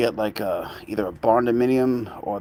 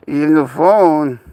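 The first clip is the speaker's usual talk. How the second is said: higher, louder, slow teasing; playful pet talk; 4 semitones higher, 7 dB louder, slower than usual. Real, not acted. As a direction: tease the pet chewing the phone. Teasing pet chewing phone.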